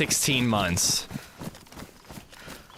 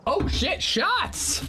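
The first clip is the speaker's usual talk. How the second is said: startled; 13 semitones higher, much slower than usual. Real, not acted. Hearing gunfire in game.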